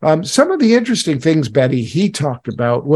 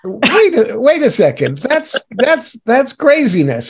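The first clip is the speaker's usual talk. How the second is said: higher-pitched, amused incredulity; playful disbelief; 8 semitones higher than usual. Real, not acted. Interviewer reacting to the long list of accents, playful disbelief. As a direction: halt everything in comic astonishment.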